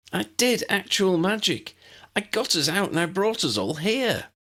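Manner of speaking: high, eager and boastful; Lydia's voice. Acted. Lydia boasts to Ambrose, high and proud.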